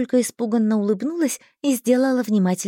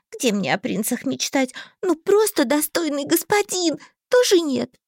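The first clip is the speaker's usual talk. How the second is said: put-on high, hurt naive girl; young girl voice; 6 semitones higher than usual. A narrator's reading. Heroine, high.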